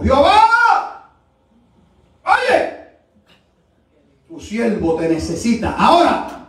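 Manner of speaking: high, loud cry to God, first word held long; full-voiced imitation of a shouted demand; hard shouted prayer. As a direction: cry out to heaven as the old woman did. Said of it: "gritó duro y dijo".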